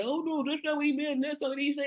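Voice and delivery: high, dismissive pundit voice; mock defensive pundit. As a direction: brush it off like a TV host. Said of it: "the M S D N C will try to say".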